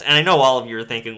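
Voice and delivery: ordinary talk, nothing marked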